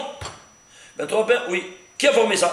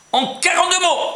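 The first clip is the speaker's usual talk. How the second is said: excited outburst; 11 semitones higher than usual. Real, not acted. Teacher stresses the key number.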